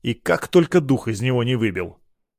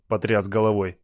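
ordinary delivery

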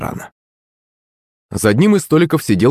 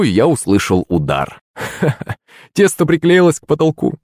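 ordinary delivery, nothing marked

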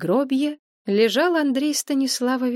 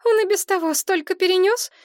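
much higher, louder plea; Maria defending; 12 semitones higher, 4 dB louder than usual. A narrator's reading. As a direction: stick up for him, shrill with concern. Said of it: "вступилась за историка Мария". (Мария заступается, громче и выше)

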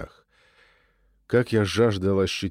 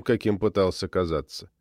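plain reading voice

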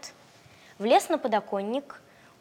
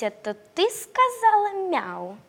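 puppy voice; 9 semitones higher, 3 dB louder, slower than usual. Acted. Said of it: "спросил щенок лягушку".

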